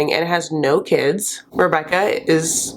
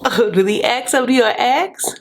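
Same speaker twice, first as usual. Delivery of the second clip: laughing; 6 semitones higher than usual. Real, not acted. Mocking the sister's rude remark.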